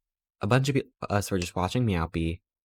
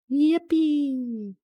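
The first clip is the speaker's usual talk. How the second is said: squealed yippee. Real, not acted. Cheering over new stickers.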